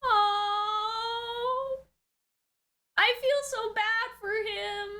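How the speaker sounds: ordinary delivery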